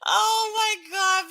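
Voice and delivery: high, slow, drawn-out disbelief; stunned oh my God. Real, not acted. Streamer reacts to a chat comment in high, slow disbelief. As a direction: stretch it out in shock.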